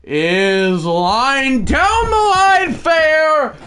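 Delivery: high, loud shouted call; excited hit call. Pretend. Calling a base hit for viewers, excited shouting announcer.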